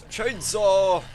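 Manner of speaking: high, loud shout; gleeful weapon cry. Pretend. Player pulls out a chainsaw, shouting it excitedly. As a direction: whoop it out like a battle call.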